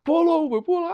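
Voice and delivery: high mocking cop shouting; mock police yell. He mocks police yelling at him, high and mocking. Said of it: "yelling at me, talking about pull over".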